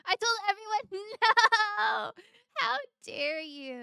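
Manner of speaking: loud laughing mock outrage. Real, not acted. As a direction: howl it out between laughs.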